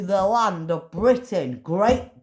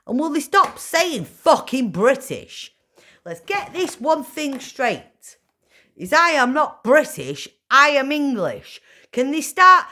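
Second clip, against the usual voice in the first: angry; 5 semitones higher, 6 dB louder than usual. Real, not acted. Furious rant about being called British.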